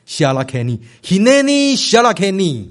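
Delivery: "Hineni Shalakeni" modelled with late stress in each word; "Hineni Shalakeni" for learners, weight toward each word's end; teaching Hebrew phrase. Shown as a demonstration. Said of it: "大家一起来学这句话".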